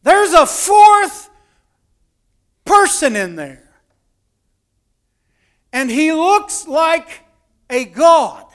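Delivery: high, very loud, slow amazement; awestruck shout. Retold or imitated. Storyteller as king seeing a fourth man, loud amazed shout. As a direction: cry it out in wonder, stretching each phrase.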